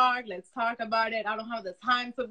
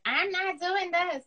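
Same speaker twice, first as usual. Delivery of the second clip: put-on whiny kid; 9 semitones higher, slower than usual. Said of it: "a normal kid would be like".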